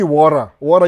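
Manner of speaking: "water" as wo-ra, with a tapped r; mistaken R sound. Shown as a demonstration. Correcting what viewers think they hear, "water" as wo-ra. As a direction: replace the t in "water" with an r. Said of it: "unasema, amesema ra".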